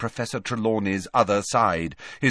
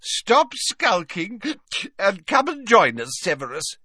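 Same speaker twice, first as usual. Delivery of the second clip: happy tipsy Slughorn; 11 semitones higher than usual. A narrator's reading. Slughorn tipsily calling Snape to join them, high and merry. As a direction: coax him over, tipsy and cheerful. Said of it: "hiccuped Slughorn happily".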